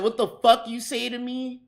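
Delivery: high-pitched mock angry challenge; retold challenge. Cook confronting his boss with a plate, incredulous challenge.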